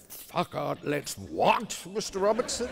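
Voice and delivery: high, outraged judge asking in disbelief; incredulous judge. Retold or imitated. Judge to young barrister, incredulous and high. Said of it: "the most terrifying of the High Court judges".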